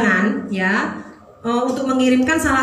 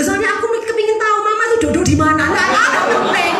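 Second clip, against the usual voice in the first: put-on higher, quicker, innocent child explaining; lightly voiced child; 8 semitones higher than usual. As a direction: play the kid making excuses.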